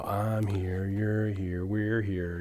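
lightly imitated creepy killer, soft and low; weird frightening voice. Podcaster mimicking a movie serial killer's voiceover, softly eerie. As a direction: murmur it like an eerie voiceover, understated. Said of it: "that really weird, frightening voice".

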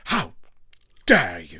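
loud, indignant cry of how dare you; mock outrage. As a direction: play up being insulted, loud and indignant.

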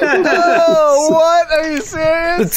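very high, amazed squeal; astonished. Real, not acted. Player reacts to a natural twenty, astonished squeal. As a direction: exclaim in thrilled disbelief.

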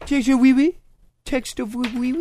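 a playful, lifted fake French accent; mock French accent. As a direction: lilt it like a cartoon Frenchman. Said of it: "They say it in their weird French accent".

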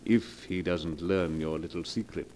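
low, smooth, unhurried menace; oily blackmailer. Acted. Blackmailer coolly naming his price to Mrs Morgan, low silky menace. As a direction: purr the threat, savouring it.

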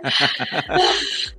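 quick chuckle; short laugh. Real, not acted. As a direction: laugh it off briefly. Laughs at Gaveta's back.

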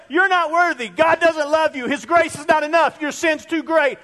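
high, loud accusing voice of condemnation. Retold or imitated. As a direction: jab each word like an inner accuser. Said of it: "It'll say".

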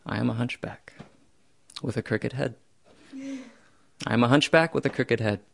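soft, calm, resigned admission; soft settled acceptance; quiet acceptance. Retold or imitated. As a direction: own it gently, without a fight. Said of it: "And I accepted it".

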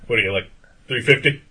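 loud heckling question; imitated taunt. Stranger guessing a customer's weight in line, loud taunting. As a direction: imitate a rude stranger needling someone loudly.

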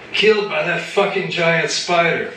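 high, raging bellow. A narrator's reading. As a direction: roar it at the crowd. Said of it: "he bellowed".

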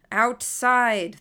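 loud command over a crowd; projected, forceful order. A narrator's reading. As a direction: raise your voice to be heard over noise. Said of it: "had to raise his voice to be heard".

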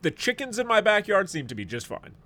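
ordinary talk, nothing marked

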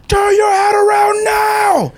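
slow, high-pitched shouted order; yelling security guard. Retold or imitated. Mall security guard ordering someone to turn around, yelling. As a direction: shout the command high, stretching every word. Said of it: "He was yelling at me".

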